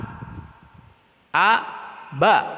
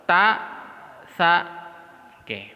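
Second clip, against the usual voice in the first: usual speaking voice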